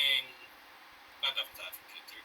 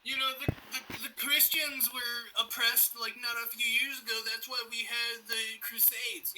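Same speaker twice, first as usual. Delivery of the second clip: mock-earnest; 7 semitones higher than usual. Podcaster mocking Christians who claim oppression, high and mock-earnest. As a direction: pitch it up in put-on earnestness.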